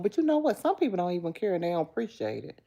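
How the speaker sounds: usual speaking voice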